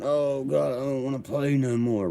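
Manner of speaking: high, whiny mock sob of a crying kid; hammed-up crybaby whine. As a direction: whine it like a kid who's losing. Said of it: "The kids was crying".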